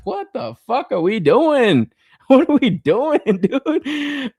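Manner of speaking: high-pitched incredulous outburst breaking into laughs; laughing exasperation. Retold or imitated. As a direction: cry out at the absurdity, half laughing.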